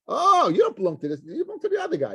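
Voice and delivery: imitating the sons pushing him away, mildly mocking; dismissive brush-off. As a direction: toss him off to the other family with a light scoff.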